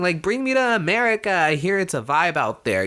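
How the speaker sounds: a high, hammed-up child voice asking where he's going; mock toddler voice. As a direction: lightly play the baby being carried off.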